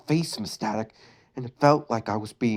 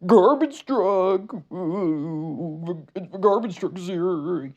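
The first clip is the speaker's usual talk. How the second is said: high, slow, gleeful cartoon dog shout; excited high cartoon yell; 7 semitones higher, much slower than usual. A narrator's reading. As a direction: shout it like a dog thrilled by the truck. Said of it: "yelled Dog gleefully".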